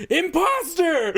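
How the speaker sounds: high, drawn-out cry of accusation; mock accusing cry. Drunk girlfriend to a party guest, mock accusing cry. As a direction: call it out like a hammered party guest.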